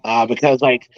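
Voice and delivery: usual speaking voice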